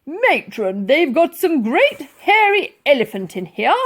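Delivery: loud gruff colonel shout; calling out. Acted. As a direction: bellow it through the door. Colonel calling through the door.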